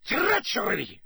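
put-on high, accented outcry; bellowed. A narrator's reading. Karkaroff accusing Dumbledore, bellowed. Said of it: "he bellowed".

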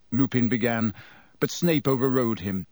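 plain reading voice

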